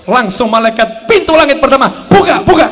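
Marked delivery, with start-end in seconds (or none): 2.1-2.7 loud high call to open the gate; urgent shout. Acted. Angels at sky's gate. (malaikat berseru buka)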